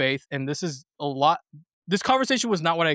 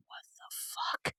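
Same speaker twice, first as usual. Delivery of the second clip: barely voiced, mouthing the words; mouthed under breath; 16 dB quieter, faster than usual. Guy behind them mouths his disbelief, nearly silent. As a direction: mouth it so only your friend notices. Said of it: "kinda mouth a little bit like".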